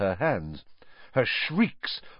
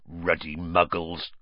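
slow, low growl; 4 semitones lower, slower than usual. A narrator's reading. Hagrid hearing of the Dursleys, low growl. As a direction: growl it slowly, pitched down. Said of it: "growled Hagrid".

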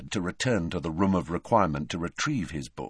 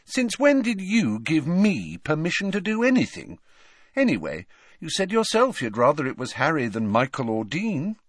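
indignant, higher-pitched retort; scoffing sibling comeback, voice raised in pitch; 8 semitones higher than usual. A narrator's reading. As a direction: argue back scornfully. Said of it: "scoffed Ginny".

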